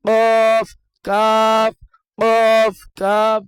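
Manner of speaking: কফ said heavy and full, কাফ said thin and light; heavy and light letters. Shown as a demonstration. Teacher repeating the heavy and light pair for students. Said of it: "কফের উচ্চারণ মোটা হবে".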